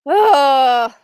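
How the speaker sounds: loud disgusted groan; frustrated groan. Real, not acted. Fan annoyed at the result, loud groan. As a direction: let out a loud ugh.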